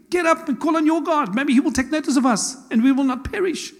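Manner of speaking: high, urgent storm-deck command; captain's cry, pitched well above normal speech. Acted. Captain urging Jonah to pray, urgent high cry. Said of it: "the captain went to him and said".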